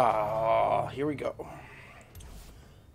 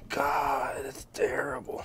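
strained gasp after drinking; 3 semitones higher, slower than usual. Real, not acted. Gagging after a shot.

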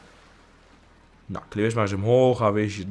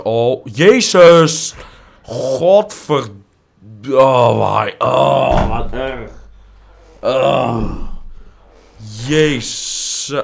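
loud, drawn-out cursing groans; shocked outburst; 8 dB louder, much slower than usual. Real, not acted. Gamer reacting to a disaster in the game, loud dismay. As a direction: cry out loudly, groaning between oaths.

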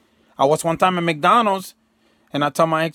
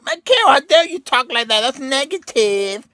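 shrill high shouting outrage at Michael, much higher and louder; mocking scolder; 8 semitones higher, 4 dB louder, slower than usual. Viewers scolding Michael for talking negative, high mocking outrage. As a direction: ham up a scandalized viewer scolding him. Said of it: "I know right now you're saying".

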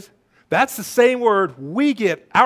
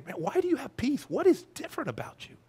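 high, softer puzzled questioning; curious onlooker; 7 semitones higher, 6 dB quieter than usual. Acted. Onlookers asking a believer about their peace, high and softly puzzled. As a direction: wonder aloud in a lifted, hushed voice. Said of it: "People can look to us and say".

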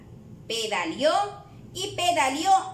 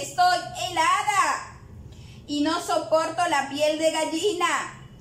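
usual speaking voice

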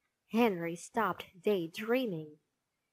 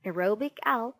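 ordinary delivery

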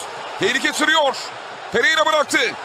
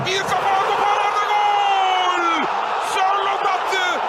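slow, stretched goal cry; drawn-out goal call; 2 semitones higher, much slower than usual. Real, not acted. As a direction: draw out every syllable. (uzatarak gol anonsu)

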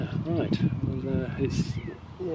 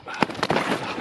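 real burst of laughter; 4 dB louder than usual. Real, not acted. Fish splashes water at him.

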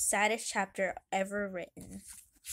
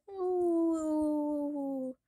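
high, long, sad howl; drawn-out pitiful "awoo"; dog howl; 5 semitones higher than usual. Acted. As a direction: answer with a mournful hound's howl. Dog answers by howling.

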